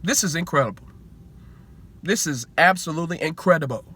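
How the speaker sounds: a hint of Magic Johnson's amazed tone; Magic Johnson impression. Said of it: "In my Magic, Magic Johnson voice".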